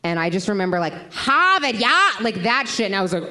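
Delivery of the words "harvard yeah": a loud, higher mock Boston cop accent. Imitating a Boston cop near Harvard, loud Boston accent. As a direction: bark it like a Boston local. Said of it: "I remember this cop".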